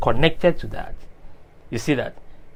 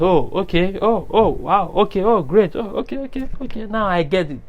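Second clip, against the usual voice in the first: higher-pitched string of dawning ohs; acted realisation; 5 semitones higher than usual. Preacher voices a listener finally understanding, pleasantly surprised. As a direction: play someone as the penny drops, voice lifting. Acts out someone suddenly understanding.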